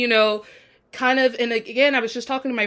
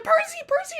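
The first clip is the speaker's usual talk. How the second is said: very high-pitched mock calling; high imitation of an urgent friend; acted urgent call. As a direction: call the name in a squeaky high voice. Voices Annabeth calling Percy.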